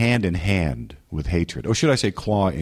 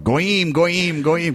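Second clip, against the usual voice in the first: higher chanted goyim, mildly raised; a hint of a spirit crying out; mock spirit yell; 10 semitones higher, much slower than usual. Demon yelling during deliverance, mild mock yell. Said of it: "he was yelling out".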